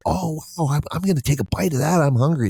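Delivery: very high mock mouse excitement; eager puppet mouse. Hungry mouse eyeing the trap's cheese, high eager voice. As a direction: imitate a greedy little mouse, high-pitched. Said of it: "the one mouse is like".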